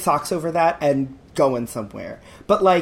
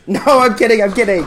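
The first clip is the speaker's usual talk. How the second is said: laughing; 3 semitones higher, 7 dB louder, faster than usual. Real, not acted. Laughing off a mean joke.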